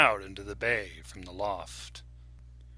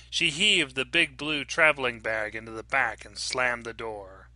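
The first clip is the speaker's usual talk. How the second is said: ordinary delivery, nothing marked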